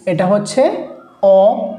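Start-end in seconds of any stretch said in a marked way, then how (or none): 1.2-1.7 অ said alone after a pause, as a rounded "aw"; isolated vowel sound. Shown as a demonstration. Teacher showing learners the sound of letter O, অ pronounced by itself. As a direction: set অ apart as a clean vowel. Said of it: "যেটা আমরা ও বলি".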